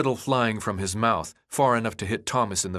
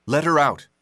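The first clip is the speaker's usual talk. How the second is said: firm, steady demand with stress on her; 4 semitones higher, 5 dB louder than usual. Acted. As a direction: say "Let her out" as a flat firm order, leaning on "her". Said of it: "Thomas stood by the cell door, arms folded".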